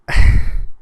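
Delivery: heavy audible exhale; sigh, tired of the subject; exasperated sigh. Real, not acted. Exasperated pause in rant.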